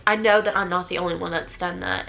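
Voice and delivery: normal talking voice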